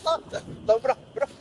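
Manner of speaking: high squealing pleas through laughter; being tickled. Real, not acted. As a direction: beg them to stop, gasping. Tickled, begging to be untied.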